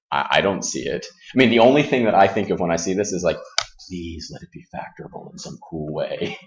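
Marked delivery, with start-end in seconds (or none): 3.9-6.4 very soft, low pleading under the breath; quietly begging; hushed plea. Retold or imitated. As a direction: murmur it like a private prayer. Voicing a silent hopeful plea.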